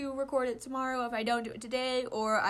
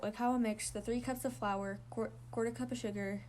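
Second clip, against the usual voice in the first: plain reading voice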